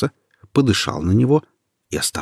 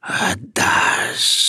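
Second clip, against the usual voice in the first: hissed breathy snake whisper; snake hissing. Acted. The snake squeezing Peter, demanding his heart, hissing. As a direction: hiss it like a serpent. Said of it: "прошипела змея".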